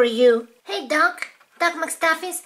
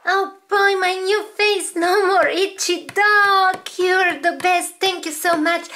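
thrilled patient; 6 semitones higher than usual. Acted. Cured doll celebrates her new face to the doctor, thrilled. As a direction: bubble over with thanks.

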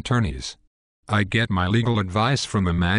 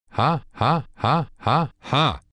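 villainous ha-ha cackle, pitched up; evil laugh; 4 semitones higher, slower than usual. Acted. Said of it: "Mine is an evil laugh".

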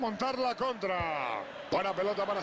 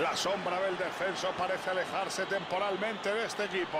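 usual speaking voice

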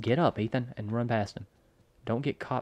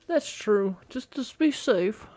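squeaky, much higher voice; silly high voice; 12 semitones higher, 4 dB louder, much slower than usual. Pretend. Watcher telling player to be safe, squeaky high voice. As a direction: repeat it in a high put-on squeak.

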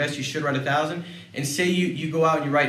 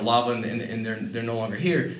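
usual speaking voice

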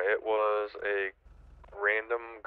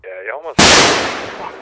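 sudden loud outburst; 17 dB louder, slower than usual. Real, not acted. Reacting to a near miss.

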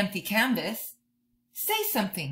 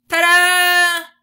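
high, loud ta-da with flourish; showy ta-da; 10 semitones higher, 13 dB louder than usual. Acted. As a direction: present yourself with a flourish. Showing the world who you are.

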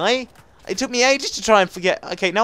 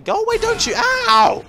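sudden squeal, much higher; high yelp; 4 dB louder than usual. Real, not acted. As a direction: squeal up high as you get hit. Hit in game, yelps.

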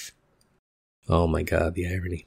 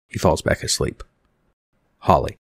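usual speaking voice

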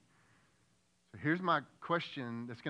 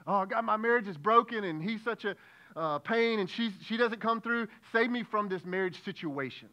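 higher, louder whiny plea; mock complaining prayer; 7 semitones higher, 7 dB louder than usual. Preacher imitating a self-centred prayer about marriage, whiny and loud. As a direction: whine at God about your marriage. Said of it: "that prayer sounds like this".

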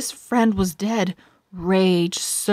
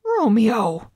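raised, louder astonished call; shocked gasp; 4 semitones higher, 5 dB louder than usual. A narrator's reading. Mercutio is shocked at Romeo's refusal, gasping the name. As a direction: say the name in disbelief. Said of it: "gasped Mercutio".

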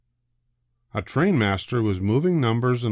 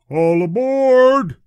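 long drawn-out high, loud call; shout; 8 dB louder, much slower than usual. A narrator's reading. Conductor calling passengers to board. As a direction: call it down the whole platform. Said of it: "the conductor shouted".

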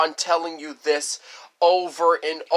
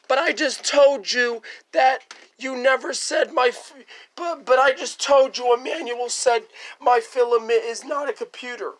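put-on high, pleading child protest; childish backtalk; whiny kid voice; 6 semitones higher than usual. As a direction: whine it like a scolded kid. Kid arguing with parent.

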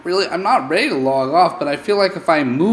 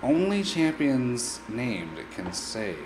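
ordinary talk, nothing marked

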